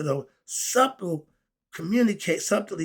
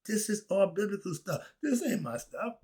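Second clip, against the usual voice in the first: ordinary talk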